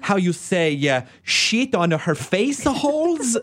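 mock sweet Italian director, searching for words; hammed-up Italian accent; imitated Italian accent. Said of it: "take his adorable accent".

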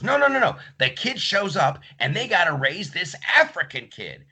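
high, insistent retold protest. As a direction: push through, won't be stopped. [name] insists over Ben.

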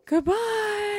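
very high sing-out goodbye; drawn-out falsetto send-off; mock grand farewell up high; silly farewell.

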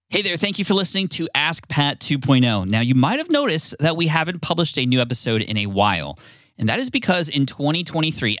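bright promo voice telling listeners the show is paused; podcast outro read. Acted. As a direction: read it like a polished podcast announcement. Recorded outro announcement.

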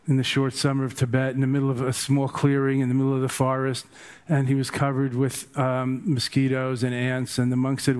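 ordinary delivery

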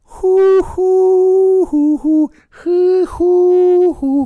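high hooting mock lute tune; imitated lute. Shown as a demonstration. Comic plays an imaginary lute for the audience, loud high hooting. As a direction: hoot out a silly lute melody, loud and high. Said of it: "Is that it? Is a lute just an owl".

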